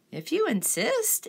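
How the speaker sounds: high, slow, sweet reply; sweetly. A narrator's reading. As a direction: answer sweetly, unafraid. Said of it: "answered the friendly ladybug sweetly".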